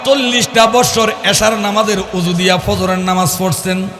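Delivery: melodic preacher's intonation; half-chant it to the congregation; drawn-out sermon cadence; waz preaching. Acted.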